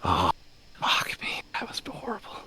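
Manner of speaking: raspy whisper, barely voiced; demonic whisper. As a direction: breathe the words out in a rasp. Revived character gasping in pain.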